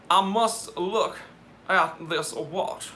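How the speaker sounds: slow, mock-genteel Southern drawl; slow Southern gentleman drawl. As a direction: savour each word like a proper Southern gentleman. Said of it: "my impression of a proper Southern gentleman".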